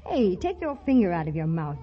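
ordinary delivery

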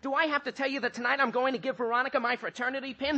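worked-up and defensive; indignant outburst. Acted. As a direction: fire off defensive questions, voice climbing to the end. Archie indignant at being questioned.

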